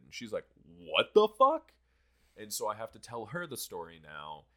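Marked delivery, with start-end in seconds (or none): 0.7-1.7 imitating a mother's shocked yell, very high and loud; loud, high, drawn-out retold shriek of disbelief; outraged mom. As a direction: stretch it out slowly in mock-outraged disbelief. Mom's shock escalates, retold.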